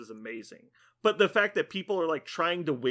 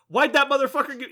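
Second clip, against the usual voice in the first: fast, high and louder, mock outrage; indignant outburst; 10 semitones higher, 6 dB louder, faster than usual. Player learning his opponent gave him zeros, indignant. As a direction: rush it out as an aggrieved player.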